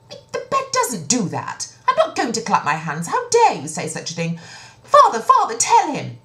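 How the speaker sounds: high, loud protest, rising to a plea; indignant princess. Acted. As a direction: deny it, then call father for help. Princess denies, pleads to father.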